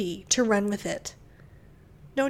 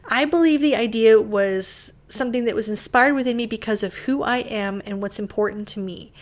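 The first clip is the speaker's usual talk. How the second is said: ordinary talk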